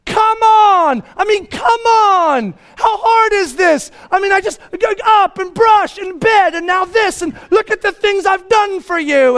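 high, strained full-volume tirade; furious dad shouting. Retold or imitated. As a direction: yell in exasperation at the kids. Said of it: "But now I'm mad now".

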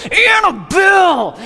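high, drawn-out furious yell; long, high scolding shout; angry scream of the name. Retold or imitated. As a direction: scream the dog's name in anger. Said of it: "I remember screaming".

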